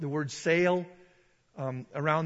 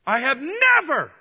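"never" stretched high and loud; slow, loud line peaking hard on "never"; stress on never; 7 semitones higher, 8 dB louder than usual. Acted. Peter refusing unclean food, "never" punched high and loud. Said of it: "underline the word".

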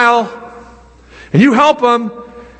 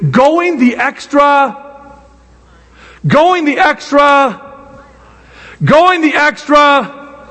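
slow emphatic repetition; 4 semitones higher, much slower than usual. Acted. Drawn-out repeated phrase.

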